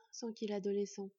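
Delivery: plain reading voice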